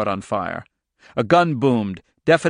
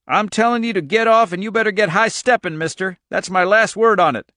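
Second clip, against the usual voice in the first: raised, louder, defiant retort; defiant farmer; 8 semitones higher, 4 dB louder than usual. A narrator's reading. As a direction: stand your ground against the intruders. Irv orders agents off his land.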